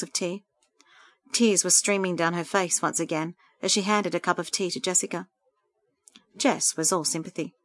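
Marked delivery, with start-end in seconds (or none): none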